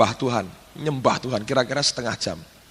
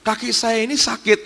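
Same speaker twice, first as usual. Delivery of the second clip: high-pitched pleading to the Lord; imitating worried servant; 9 semitones higher than usual. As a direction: complain meekly in a raised pitch, as the maid. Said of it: "dia bilang".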